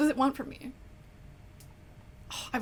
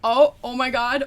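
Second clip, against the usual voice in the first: surprised; 11 dB louder than usual. Real, not acted. Surprise when it works.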